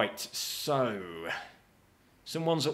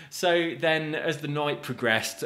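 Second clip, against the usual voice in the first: ordinary talk, nothing marked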